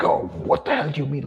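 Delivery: high-pitched indignant retort, imitated; put-on high voice of offended shock; mock affronted. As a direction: mimic someone reacting as if slapped. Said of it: "react like you've slapped them".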